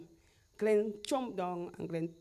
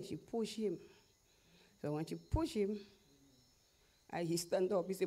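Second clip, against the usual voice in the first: ordinary delivery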